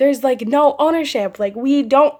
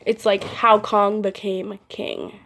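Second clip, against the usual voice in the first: ordinary delivery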